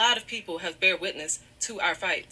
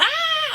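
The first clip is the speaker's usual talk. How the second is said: high, loud imitated wailing cry; loud mock sob; 7 dB louder than usual. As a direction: burst out with a hammed-up howl of crying. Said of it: "crying or nothing like that".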